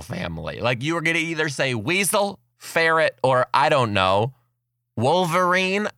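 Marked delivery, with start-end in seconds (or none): none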